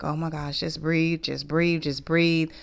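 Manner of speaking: very soft, hushed self-talk to keep calm; telling herself to breathe, barely above a breath. Retold or imitated. Retelling how she tried to calm herself in the hotel, murmured softly. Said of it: "And I was like".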